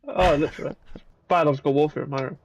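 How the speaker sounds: ordinary talk, nothing marked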